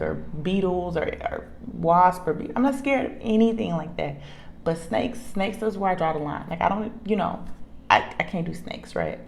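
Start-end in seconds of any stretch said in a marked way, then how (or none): none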